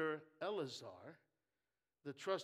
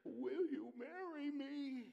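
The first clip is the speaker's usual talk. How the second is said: higher, slow, trembly-hearted suitor's plea; mock quivering proposal; 9 semitones higher, slower than usual. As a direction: ham up a lovesick young man proposing. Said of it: "you go quivering".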